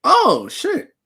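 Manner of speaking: high, loud jolt of surprise; surprised. Real, not acted. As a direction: blurt it out, caught off guard. Just learned of travel plans.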